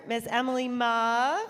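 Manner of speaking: ordinary delivery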